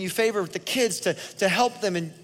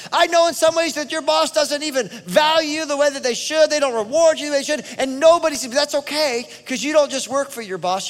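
louder, higher, driving sermon voice; full-force preaching; 7 semitones higher, 5 dB louder than usual. Acted. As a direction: preach it hard to the congregation.

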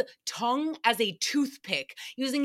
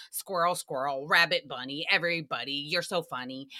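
put-on a lower, dopey drunk inner voice; tipsy inner voice; 7 semitones lower than usual. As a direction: play the drifting buzzed mind, lower. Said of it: "my mind is just like".

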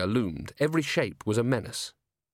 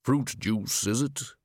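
rolling shadowy barman voice; deep rolling voice; slower than usual. A narrator's reading. Barman asks Angua her order, deep and rolling. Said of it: "in a deep, rolling voice".

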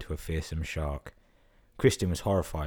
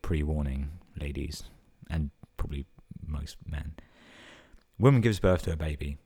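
plain reading voice

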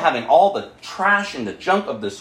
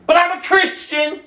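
mock protest; 10 semitones higher, 4 dB louder than usual. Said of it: "because of you".

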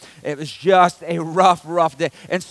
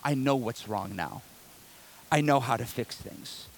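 relieved calm; 7 semitones lower, 9 dB quieter than usual. Retold or imitated.

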